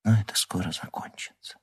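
soft sorrowful whisper; sad whisper. Acted. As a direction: whisper the comfort sadly. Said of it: "печально прошептала Лиза".

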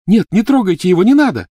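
high frantic scream. Acted. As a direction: cry out in panic. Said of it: "Гермиона закричала".

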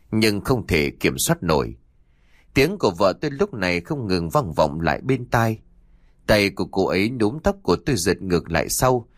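plain reading voice

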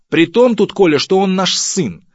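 high, loud emphatic retort; wife shouting back over their son; indignant shout. Acted. (возмущённый крик матери)

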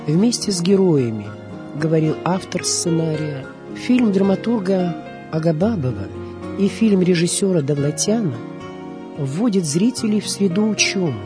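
ordinary talk

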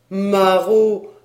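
loud, drawn-out indignant cry; acted outrage, booming; outraged rebuke. A narrator's reading. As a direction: hurl the insult like scandalized priests. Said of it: "lui dirent en chœur les cinq messieurs prêtres".

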